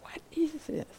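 whispered question, very soft; on the out-breath. Shown as a demonstration. Teacher shows asking the question with the breath, whispered on exhale. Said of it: "and as you breathe out".

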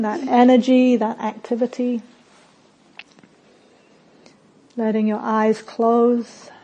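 calm meditation voice with long pauses; unhurried, settled guidance, softening at phrase ends; guided meditation. Real, not acted. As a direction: guide them gently inward, phrase by phrase.

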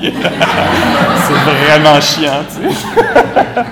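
loud, laughing through it, drawn out. Real, not acted. To the audience, laughing about the student's note. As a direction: burst out laughing at the complaint.

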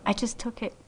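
normal talking voice

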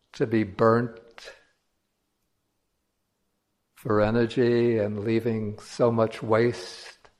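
measured meditation voice with long silences between phrases; solemn, unhurried contemplative delivery; guided meditation. Acted. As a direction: guide listeners slowly, drawing out key words.

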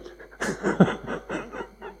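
airy laugh up high; high breathy laugh. Real, not acted. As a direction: let a light laugh escape. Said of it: "laughs at the exam point".

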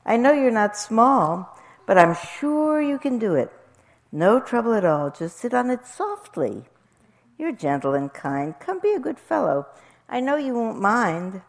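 high, coaxing bird voice; lilting, persuasive mother bird; Maisie. A narrator's reading. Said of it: "answered Maisie".

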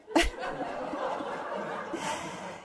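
soft airy laughter; breathy laugh. Real, not acted. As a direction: let a quiet laugh out. Laughs at the story.

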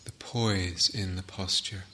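calm, unhurried meditation guidance with long pauses; settled, spacious meditation-teacher voice; guided meditation. Real, not acted. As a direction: guide the sitters gently, phrase by phrase, leaving long silences. Leads a sitting meditation.

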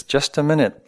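a hint of a frail old lady; frail elderly voice. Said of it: "answers a frail elderly voice".

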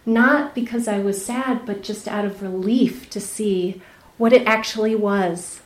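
ordinary delivery

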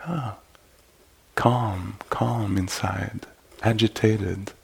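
soft sigh into slow, quiet words; hushed noticing. Shown as a demonstration. Teacher modelling noticing calm in the present moment, hushed. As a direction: let it out softly, as if just noticing.

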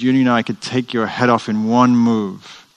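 hammed-up angry samurai, not full rage; furious threat. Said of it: "And he's furious".

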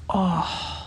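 soft, drawn-out moaning sigh; kvetching groan. Shown as a demonstration. Teacher moving the spine with the class, soft groaning sigh. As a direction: let out a soft body groan. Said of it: "let your body kvetch for a moment and just go".

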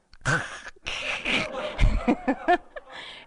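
a long, amused laugh rising up; real laughter. Real, not acted. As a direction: laugh freely at the absurdity. Laughs at her own story.

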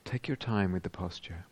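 unhurried meditation voice with long pauses; guided meditation. Real, not acted. Teacher guiding meditators into whole-body awareness, calm and spacious. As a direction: guide them calmly, leaving space after each phrase.

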